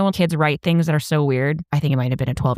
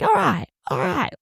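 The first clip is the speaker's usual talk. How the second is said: happy Australian imitation; 7 semitones higher than usual. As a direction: mimic an over-happy Aussie. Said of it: "they're just always so happy".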